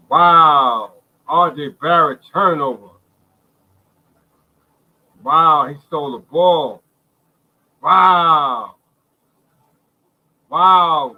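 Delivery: loud, thrilled shouts of wow; real joy bursting out at the steal; excited wows. Real, not acted. Fan shouting wow at the steal, thrilled. Steal during live game reaction.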